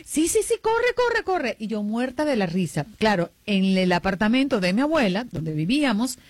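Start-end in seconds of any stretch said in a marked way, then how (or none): 0.1-1.6 high, urgent shooing; mock hurry. Big sister shoos her little sister out to the bus, high and urgent. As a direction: rush her out with fake panic.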